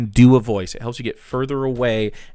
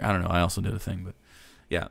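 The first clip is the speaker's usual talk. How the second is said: ordinary talk, nothing marked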